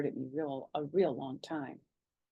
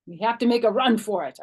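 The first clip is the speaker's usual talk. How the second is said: urgent; 4 semitones higher, 9 dB louder than usual. A narrator's reading. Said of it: "I told them".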